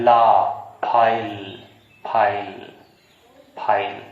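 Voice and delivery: "फाइल" drawn out slowly, ending on the l; silent final e. Shown as a demonstration. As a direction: stretch "फाइल" and let the last e vanish. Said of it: "ई पे हम stress नहीं देंगे".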